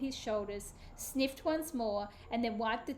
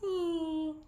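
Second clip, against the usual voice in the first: drawn-out tired yawning sigh; girl yawning before sleep; sleepy yawn; 6 semitones higher than usual. A narrator's reading. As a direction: yawn like a drowsy child. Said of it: "Hannah yawned".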